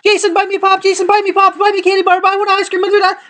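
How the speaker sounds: high-pitched imitation of begging children; pestering kids. Kids swarming a man for pop and candy, high pestering voice. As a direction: rattle it off as a whiny mock kid voice.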